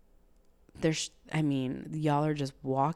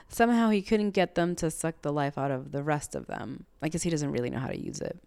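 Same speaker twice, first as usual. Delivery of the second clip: usual speaking voice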